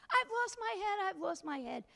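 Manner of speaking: a high, loud panicky yelp that settles; panicked skeleton. A narrator's reading. Headless skeleton frets aloud, high and hammed-up. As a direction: cry out in mock panic. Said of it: "said the skeleton".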